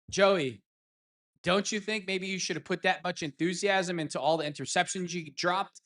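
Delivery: ordinary delivery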